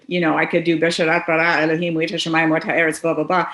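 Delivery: normal talking voice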